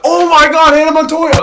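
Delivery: loud, high excited exclamation; re-enacted burst of excitement; excited rediscovery. Recalls excited rediscovery of a creator.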